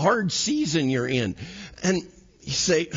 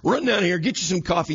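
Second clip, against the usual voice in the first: normal talking voice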